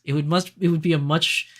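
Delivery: ordinary delivery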